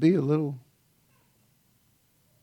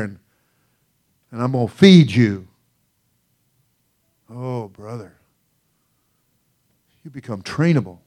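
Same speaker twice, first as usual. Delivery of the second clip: normal talking voice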